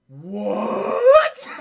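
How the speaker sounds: very loud, drawn-out mock-shocked yell; shocked outcry. Goliath hears who really shot Elisa, shocked outcry. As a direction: blow up the word in comic disbelief. Goliath reacts to Broadway's confession.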